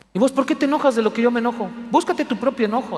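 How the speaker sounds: hammed-up quick angry comeback; mock angry retort. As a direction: snap back at the kid, half joking.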